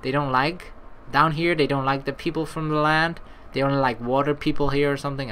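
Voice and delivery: ordinary talk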